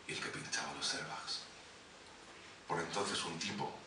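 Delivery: intimate poetry recital with measured pauses; spoken-word poem. Acted. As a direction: recite it line by line, letting phrases hang. Recites his love poem.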